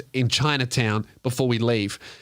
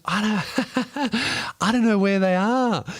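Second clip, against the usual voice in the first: put-on drunk giggle; 8 semitones higher, slower than usual. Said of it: "She goes".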